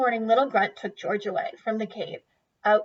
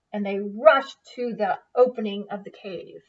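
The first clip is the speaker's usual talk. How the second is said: plain reading voice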